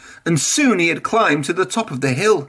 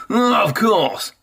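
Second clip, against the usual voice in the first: much higher, smug and showy; boasting; 8 semitones higher than usual. A narrator's reading. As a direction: toss it off like the best engine around. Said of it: "boasted Spencer".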